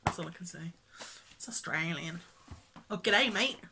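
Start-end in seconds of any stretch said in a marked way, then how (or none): 1.3-2.2 lowered, soft mock-Australian voice; playful low Australian accent attempt. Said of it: "That's my Australian accent". 2.9-3.6 hammed-up Aussie greeting; playful stock Australian impression; Australian accent. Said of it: "That's my Australian accent".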